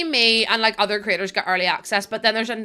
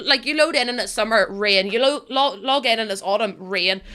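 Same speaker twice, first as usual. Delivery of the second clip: ordinary delivery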